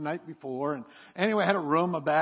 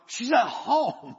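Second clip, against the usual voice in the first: startled reply; 7 semitones higher, 6 dB louder, much slower than usual. Retold or imitated. Startled answer to the cop.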